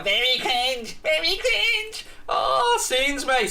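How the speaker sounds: slow sneering mimic of the commenter, rising high on the second cringe; mocking commenter. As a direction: drag it out like a whiny troll, pitch jumping up at the end. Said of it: "and he's going".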